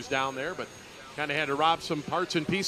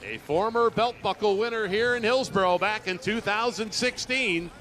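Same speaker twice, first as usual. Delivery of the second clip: rally the crowd after a stout run; excited call; 5 semitones higher than usual. Real, not acted.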